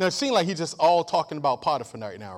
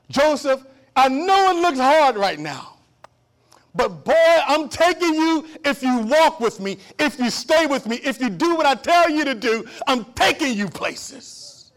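loud, high voice of God promising; 8 semitones higher, 8 dB louder than usual. Acted. God calling to Joseph in prison, loud and high. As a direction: call out to Joseph like God himself.

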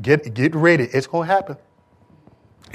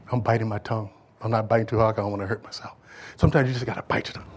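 quiet aside; 6 semitones lower, 9 dB quieter, faster than usual. Real, not acted. Soft aside.

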